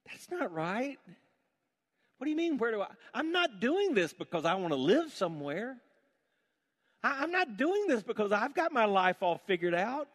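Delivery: impassioned, rising to a loud cry; fervent young self. Retold or imitated. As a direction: pour out a young man's pledge. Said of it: "even as a young seminarian thinking".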